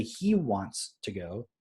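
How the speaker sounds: very slow, carefully spelled-out "He wants to go"; slow teaching demo; model sentence. Shown as a demonstration. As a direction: teach it word by word. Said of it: "we say".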